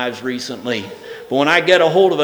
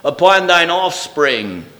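raised, ringing King James reading with strong falling phrase ends; scripture reading; 5 semitones higher, 5 dB louder than usual. Acted. Preacher reads Isaiah to the congregation, ringing reading voice. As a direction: read it out like God's own declaration.

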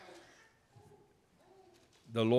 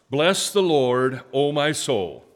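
slow, loud, raised leading line; slow, loud scripture line for the congregation to echo; emphatic call to repeat; 5 semitones higher, 10 dB louder, slower than usual. Shown as a demonstration. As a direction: lead the church in a call-and-response reading. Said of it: "You repeat after me with the same expression".

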